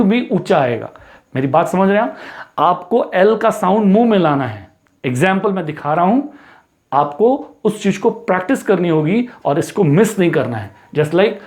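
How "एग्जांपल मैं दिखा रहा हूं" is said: normal talking voice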